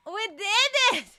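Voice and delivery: high, loud victory yell; excited cheer. Real, not acted. Streamer celebrating success in the game, high excited cheer. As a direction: cry it out in triumph.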